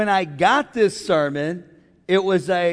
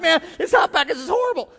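mock complaining husband; 11 semitones higher than usual. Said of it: "you're like".

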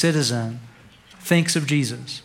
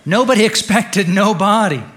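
louder, higher, driven straight through; emphatic repeated punchline; 5 semitones higher, 5 dB louder than usual. Real, not acted. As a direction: hammer "Nobody expected no body" home. Repeats pun after weak laugh.

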